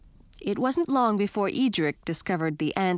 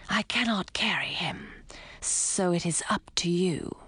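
hissing snake whisper; 3 dB quieter than usual. A narrator's reading. Fang the snake's voice.

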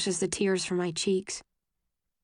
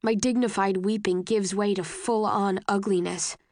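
ordinary delivery, nothing marked

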